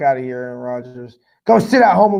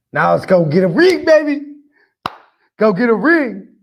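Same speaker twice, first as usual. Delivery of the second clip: hyped rallying cry; 5 semitones higher, 4 dB louder than usual. Real, not acted. Fan hyped for Super Bowl.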